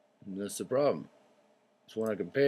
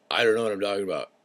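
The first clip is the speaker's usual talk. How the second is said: fast, clipped mock confession; mock forgetful quote; 5 dB louder, faster than usual. Quoting someone who lost their thought, fast and mocking. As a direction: rattle it off fast and dismissive. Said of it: "and you say".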